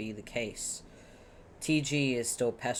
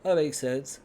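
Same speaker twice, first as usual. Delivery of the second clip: ordinary talk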